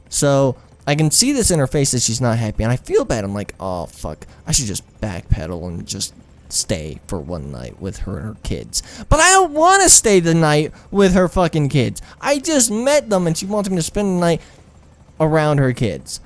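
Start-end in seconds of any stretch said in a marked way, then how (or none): 3.6-8.8 low, soft self-talk; muttered inner thought. Retold or imitated. Guilty inner thought to himself. 9.1-16.2 high, worked-up protest; exasperated protest. Retold or imitated. Protesting staying with her kids.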